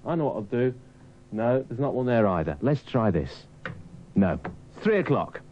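ordinary talk

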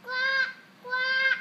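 shouting quack quack at full volume; loud quacking. Real, not acted. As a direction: yell the duck sounds out loud. Toddler shouting duck sounds.

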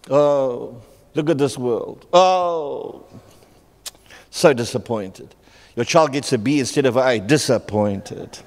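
low, slow, sighing disappointment; mock disappointment. Mimicking a disappointed parent over a B, low sigh.

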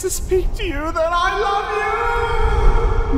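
high, pleading, breaking voice; cling to her as she fades; tearful farewell. Acted. Last words to dying friend.

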